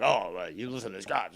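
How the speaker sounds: ordinary talk, nothing marked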